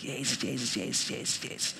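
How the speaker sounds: hushed, repeated calling of a name; soft whisper. Retold or imitated. Man retelling his quiet call to Jesus, whispering. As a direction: whisper it urgently over and over. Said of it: "Zo zachtjes".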